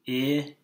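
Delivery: K-id sounded out in two parts; blended sounds. Shown as a demonstration. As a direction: split K-id into onset and rime. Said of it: "I'll do it with you".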